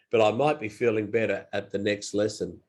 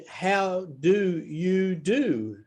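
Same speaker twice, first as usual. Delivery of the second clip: slow and clear; 5 semitones higher, much slower than usual. Shown as a demonstration. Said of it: "it means".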